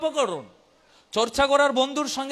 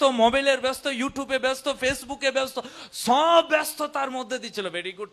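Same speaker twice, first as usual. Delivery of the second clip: normal talking voice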